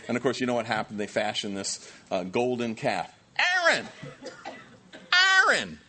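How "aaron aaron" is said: high laughing outburst of disbelief; incredulous cry. Real, not acted. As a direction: cry the name out in amused shock. Preacher exclaims in disbelief.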